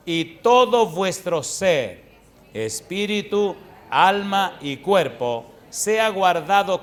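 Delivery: slow, emphatic pulpit reading; scripture reading. Real, not acted. Preacher reading a verse to the congregation, slow and emphatic. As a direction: draw each phrase out with force.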